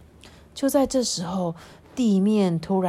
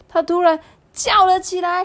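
high, loud narration; building alarm; 9 semitones higher, 5 dB louder than usual. A narrator's reading. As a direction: raise the voice into a sudden alarm. (突然提高声音讲述)

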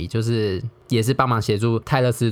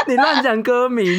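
laughing; 13 semitones higher than usual. Real, not acted. Teasing friend over wrong title.

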